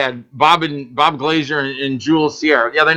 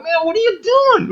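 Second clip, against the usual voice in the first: much higher, fast, stunned boss voice; incredulous boss; faster than usual. Retold or imitated. The boss watching a worker breathe on him, stunned and high. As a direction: squeak it out in disbelief. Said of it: "my boss is even like".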